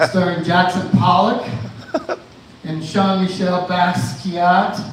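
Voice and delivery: ordinary talk, nothing marked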